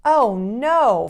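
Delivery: loud, high cry of dismay. Acted. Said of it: "First Woman cried".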